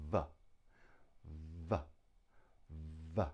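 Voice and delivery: V as a buzzing "vvv" released briefly; isolated v sound. Shown as a demonstration. Teacher models a sound missing in Japanese, V as a buzzed consonant. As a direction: hold the buzz of V, not the letter's name. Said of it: "the other letter you need to think about".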